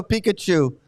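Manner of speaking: usual speaking voice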